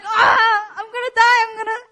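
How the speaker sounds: high, loud mock panic broken by laughter; laughing panic. Retelling sinking in the river, high laughing panicked cry. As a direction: cry out in half-laughing terror. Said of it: "So I'm like".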